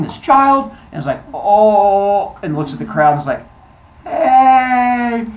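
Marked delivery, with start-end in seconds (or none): 1.3-2.4 loud, drawn-out oh; sudden realisation. Acted. Goddess caught beating a child. 4.0-5.3 high, loud, drawn-out hey; sheepish greeting. Acted. Caught goddess greets staring crowd.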